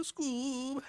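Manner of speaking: high squeaky Shaggy; cartoon Shaggy call. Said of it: "teenage Shaggy".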